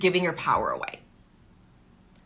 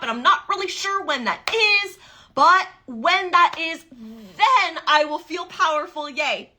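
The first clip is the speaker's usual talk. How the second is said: mocking passive waiting; 7 semitones higher than usual. Coach mocking the passive mindset she rejects, much higher and laughing. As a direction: make fun of someone just waiting for money. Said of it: "That's how I want you to think. Not".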